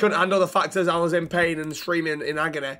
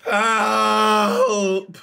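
a loud, higher, strained call; cry for help; 4 semitones higher, 4 dB louder than usual. Acted. Injured patient calling out for help, a loud cry. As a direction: shout for help like you're hurt.

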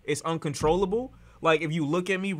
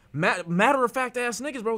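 ordinary delivery

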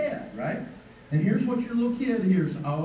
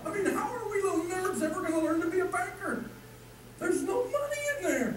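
mock nerd whine; 11 semitones higher, 4 dB quieter than usual.

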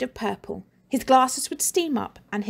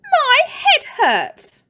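much higher, louder, slow whine; whiny boy; 7 dB louder, much slower than usual. Acted. Injured boy in hospital wails about his head, whining. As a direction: wail like a hurt little boy.

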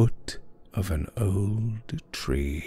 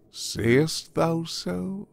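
slow, high questioning lilt; understated teasing through a private smile; sly amusement; 11 semitones higher, much slower than usual. A narrator's reading. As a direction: draw out the question, lightly amused. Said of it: "smiling apart".

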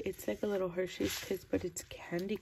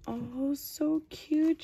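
high, drawn-out cooing; real delighted coo over something cute; delighted gushing; 8 semitones higher, 5 dB louder, much slower than usual. Real, not acted. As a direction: gush slowly in a high, louder voice. Delighted on seeing gift.

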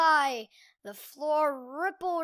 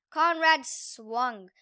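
ordinary delivery, nothing marked

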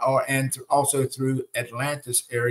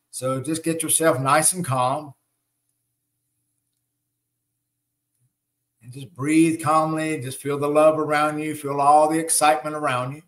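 guided meditation. Acted. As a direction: ease the group into breathing calmly.

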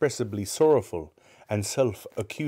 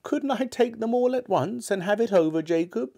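put-on high, timid old man's voice; hinting Scrooge; 13 semitones higher than usual. A narrator's reading. Scrooge hints to the ghost, high and timid. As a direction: slip the hint in hopefully. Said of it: "hinted Scrooge".

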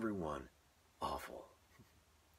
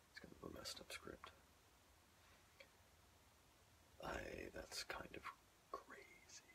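soft unvoiced whisper; 12 semitones lower, 15 dB quieter than usual. Real, not acted. Whispering a comment about the odd script. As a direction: whisper the aside. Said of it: "whispered comment".

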